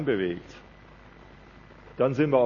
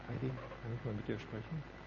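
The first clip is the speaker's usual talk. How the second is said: quietly calling a name, softer; soft gentle call; 14 dB quieter than usual. Acted. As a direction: ask her gently and quietly. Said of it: "der kommt und sagt". (leise, sanft rufend)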